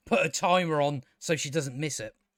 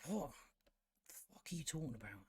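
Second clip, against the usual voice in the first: very quiet, quick, stunned aside; baffled, barely voiced; stunned disbelief; 2 semitones lower, 21 dB quieter, faster than usual. Real, not acted. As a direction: say it under your breath in disbelief. Stunned by absurd line.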